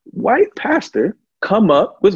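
loud, high-pitched plea, slowed for weight; emphatic warning. Real, not acted. Heated advice to a congregant.

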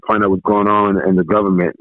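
usual speaking voice

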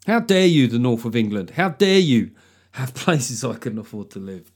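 hammed-up offence at pricey houses; mock outrage.

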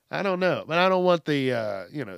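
ordinary talk

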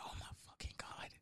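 fast, hushed whisper; a frantic whispered curse; panicked whisper. Retold or imitated. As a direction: whisper so the roommate won't hear. Hiding as roommate comes home.

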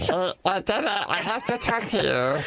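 a hint of a four-year-old; imitating daughter. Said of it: "how does your daughter sound".

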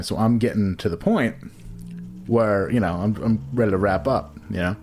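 usual speaking voice

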